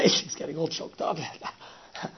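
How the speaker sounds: ordinary delivery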